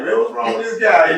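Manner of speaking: loud, incredulous quote of his own reaction; baffled outburst. Retold or imitated. Recalling his shock at a man's embrace, baffled outburst.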